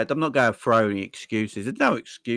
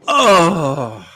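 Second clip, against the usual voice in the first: anguished wail; 6 semitones higher, 9 dB louder than usual. Real, not acted. Cry of despair at club.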